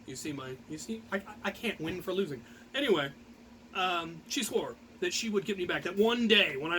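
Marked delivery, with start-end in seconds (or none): none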